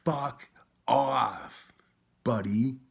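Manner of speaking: high, loud angry yell; retold yelled comeback; loud retort. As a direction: shout it right in his face. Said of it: "and I was like".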